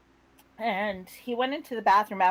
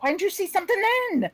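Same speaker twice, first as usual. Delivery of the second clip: fast, high-pitched retold reproach; exasperated; 11 semitones higher, faster than usual. Wife scolds husband for not noticing sooner, exasperated. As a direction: fire it off in exasperated disbelief.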